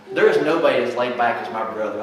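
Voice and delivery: usual speaking voice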